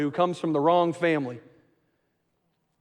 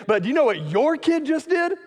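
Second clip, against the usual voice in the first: put-on the spouse who blames the other for the kid, pitched way up; exasperated spouse; 9 semitones higher than usual. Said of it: "sounded something like this".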